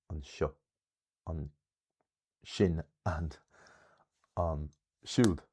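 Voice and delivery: "an seo", "shin", "an siúd" stressed after "an"; light "an", weight on "seo", "shin" and "siúd"; stress on second syllable. Shown as a demonstration. Teacher giving forms outside Munster, second-syllable stress. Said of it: "secondary stress".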